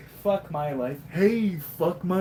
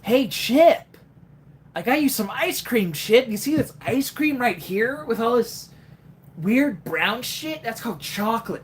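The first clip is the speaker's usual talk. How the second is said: higher, upbeat cartoon hedgehog voice; chirpy Sonic chatting to Chip; Jason Griffith Sonic; 3 semitones higher than usual. Sonic impression talking to his buddy Chip, higher and peppy. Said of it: "I can do the Jason Griffith Sonic voice".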